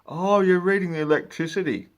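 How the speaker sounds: high, loud mock realisation; hammed-up surprised customer. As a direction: act out a dim homeowner catching on. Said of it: "they say".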